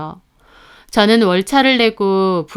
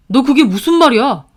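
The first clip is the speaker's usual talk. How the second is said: shocked disbelief; 4 semitones higher, 6 dB louder than usual. A narrator's reading. Brother stunned by cancer news.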